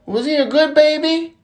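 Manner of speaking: put-on high-pitched childlike asking; little boy's question. Little Johnny asking the pregnant lady, high child voice. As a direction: ask in a high kid voice.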